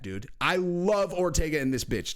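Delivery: normal talking voice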